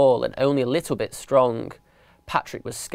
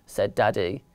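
usual speaking voice

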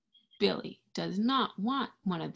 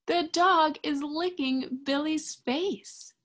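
delighted; 8 semitones higher than usual. Acted. Delighted reaction for the children.

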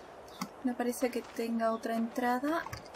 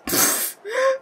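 sharp high gasp of fright; startled gasp; 13 semitones higher, 7 dB louder than usual. Real, not acted. Player spooked by the closing door, gasping. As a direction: gasp as if jumped at.

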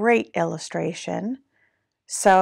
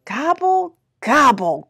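grumbled turkey gobble, second one punched; mock-grumpy, high first gobble then dropping; grumbling gobble; 6 semitones higher, 6 dB louder than usual. A narrator's reading. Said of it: "grumbled Turkey".

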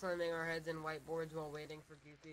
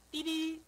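high, loud two-note beep; imitated radio beep; 10 semitones higher, 8 dB louder than usual. Showing friends the radio signal, imitated high beep. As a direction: sing out an electronic beep.

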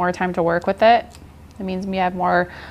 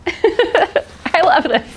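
laughing; 10 semitones higher, 4 dB louder, much slower than usual. Real, not acted. Laughing while agreeing.

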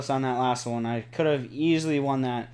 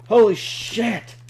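high, loud, drawn-out exclamation; shocked outburst; 7 semitones higher, 6 dB louder, slower than usual. Real, not acted. Player reacting to sudden game shock, shocked outburst. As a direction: burst out, stretching the swear.

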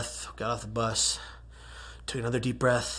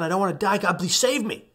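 loud, high, panicked begging; desperate plea; 7 semitones higher, 5 dB louder than usual. Retold or imitated. Falling man begging God to save him, panicked. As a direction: beg for your life in a rush.

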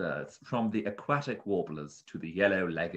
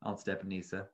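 plain reading voice

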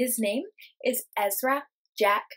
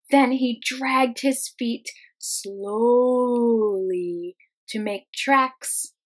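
drawn-out, slow and louder, like dragging feet; slow dragging read; 8 dB louder, much slower than usual. Acted. Teacher acting out Peter dragging his feet, slow and drawn out. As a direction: stretch every word as his feet drag.

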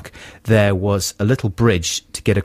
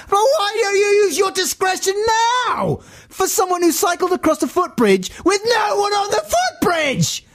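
high, furious yelled retort; outraged shout. Pretend. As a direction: hurl it at the officer at the top of your voice. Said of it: "I wasn't saying it like that".

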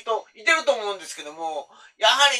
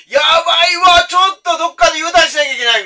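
panicked; 8 semitones higher, 8 dB louder than usual. Retold or imitated. Panicking to turn back.

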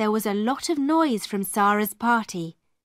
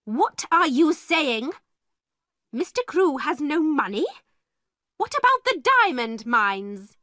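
sharp, higher and louder outraged demand; a headmistress furious at bad news; angry; 5 semitones higher, 3 dB louder than usual. A narrator's reading. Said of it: "looked at the lawyer angrily".

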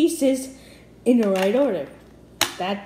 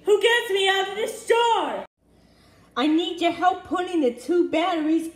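calling out; 6 semitones higher, 3 dB louder than usual. Pretend. Trapped toy calling out for help.

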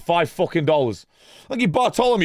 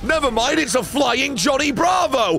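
excited yelling; 10 semitones higher than usual. Real, not acted. Streamer shouting as car flies off, excited yelling. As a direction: yell it in disbelief.

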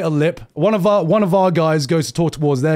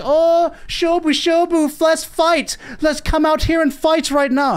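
high-pitched, hammed-up eager anime fighter; genki guy; 10 semitones higher than usual. Genki character challenging others to a fight, over-eager. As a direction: burst out in a much higher voice, itching to fight. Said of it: "this one Genki guy".

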